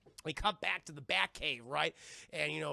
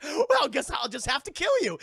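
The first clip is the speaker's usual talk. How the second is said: higher, much louder mock snap; villain threat; 7 semitones higher, 9 dB louder than usual. Woodrue condemning Pamela, loud hammed threat. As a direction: flip to menace. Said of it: "It's like".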